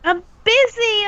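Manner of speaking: ordinary talk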